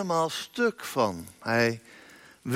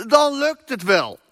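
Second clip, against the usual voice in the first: calling out; 9 semitones higher, 5 dB louder than usual. A narrator's reading. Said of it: "riep Jezus".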